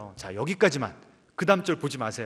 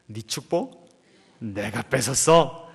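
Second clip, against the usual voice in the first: slow, sly gloating voice; a smirking younger brother taunting; smug grin; 6 semitones lower, slower than usual. Acted. Jacob smirking at his brother, sly and slow. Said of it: "씩 웃지 않겠습니까?".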